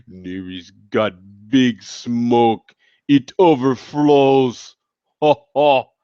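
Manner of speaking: slow, put-on Russian accent. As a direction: play a Russian big guy, drawing out each word, ending on a laugh. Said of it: "like that a Russian accent".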